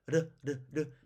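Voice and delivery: 르 as short R taps; quick, light tap for 르; flapped R. Shown as a demonstration. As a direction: flick the tongue on each 르. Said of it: "탄설음이 맨 앞에".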